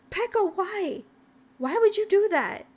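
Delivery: high, pleading complaint; whiny protest. Real, not acted. Streamer asking Pecco why the prank, high plaintive protest.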